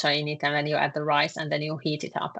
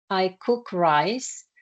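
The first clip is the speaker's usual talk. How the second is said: I cook rice said slowly and deliberately; slow translation; much slower than usual. Shown as a demonstration. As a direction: spell it out slowly for the learner.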